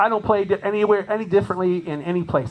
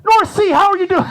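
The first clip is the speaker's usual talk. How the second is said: much higher and louder, laughing mock shout back; mock crowd correction; 9 dB louder than usual. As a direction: tease her by shouting the right city. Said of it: "they're like".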